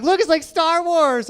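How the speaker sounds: high-pitched excited exclamation; mock excitement. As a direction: imitate a thrilled girlfriend. Girlfriend joking about glow stick.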